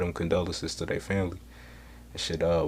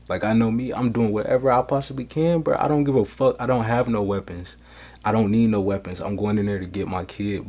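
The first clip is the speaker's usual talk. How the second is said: impassioned insistence; 3 semitones higher, 5 dB louder than usual. Real, not acted. Father vowing to rescue his kid, worked up and insistent. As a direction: push it out with fierce resolve.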